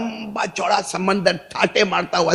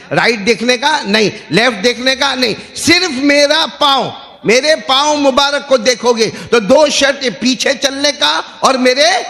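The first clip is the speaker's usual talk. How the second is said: high, raised, insistent delivery; forceful preaching; 7 semitones higher than usual. Acted. Preacher drilling two conditions into congregation, forceful. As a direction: hammer each condition home to the crowd.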